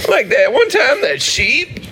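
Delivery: put-on Southern accent. Southern bit.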